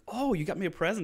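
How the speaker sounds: high-pitched delighted retelling; excited surprise. Retold or imitated. Father learns of a present from daughter, excited. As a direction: voice a dad thrilled by an unexpected gift. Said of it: "actually excited about it".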